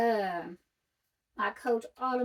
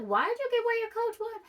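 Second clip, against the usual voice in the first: mock viewer question; 11 semitones higher, much faster than usual.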